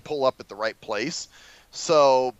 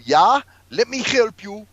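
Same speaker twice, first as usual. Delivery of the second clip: slow, higher mock foreign accent; mock broken English; 6 semitones higher, 5 dB louder, slower than usual. Mocking the Lyft driver's few English words, slow mock foreign accent. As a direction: drag it out like a driver with little English. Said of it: "this fucker only knew".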